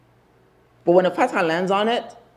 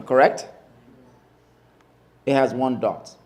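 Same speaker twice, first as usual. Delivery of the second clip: ordinary talk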